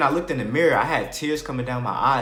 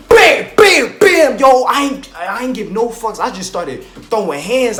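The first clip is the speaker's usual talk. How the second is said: much higher and much louder, hyped up; hyped fight yell; 9 semitones higher, 13 dB louder than usual. Retold or imitated. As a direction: yell it like you're back in the fight. Fight story climax, hyped up.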